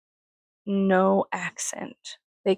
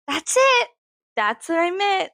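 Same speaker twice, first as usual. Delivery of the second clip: high, excited retold exclamation; delighted recognition; 9 semitones higher, 3 dB louder than usual. Customer to clerk on getting the barbecue juice, delighted re-enactment. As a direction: act out relief at being understood, pitch jumping up.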